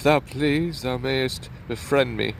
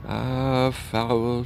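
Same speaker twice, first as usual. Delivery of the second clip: ordinary talk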